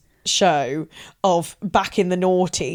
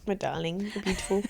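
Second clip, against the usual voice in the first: much softer, tender and soothing; 10 dB quieter, faster than usual. Real, not acted. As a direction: coo it gently to a friend. Tender compliment to her friend.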